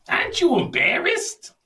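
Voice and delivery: ribbing his friend in a loud mock Chicago accent. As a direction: tease him in a hammed-up Chicago accent. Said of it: "that's my Chicago accent".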